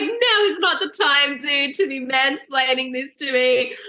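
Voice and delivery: high, slow, fed up; exasperated snap. Retold or imitated. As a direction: cut off the mansplaining. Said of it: "trying to mansplain to me".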